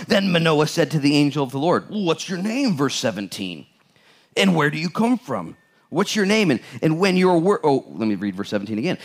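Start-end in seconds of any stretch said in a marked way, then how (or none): 0.1-7.6 raised, emphatic Bible reading voice; scripture reading. Acted. Pastor reading Judges to the church, emphatic reading voice. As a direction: proclaim the verses to the congregation. Reads verse seventeen.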